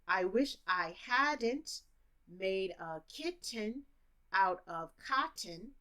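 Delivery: slow, sentence broken into three chunks; slow chunked teaching version. Shown as a demonstration. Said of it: "if I'm a teacher".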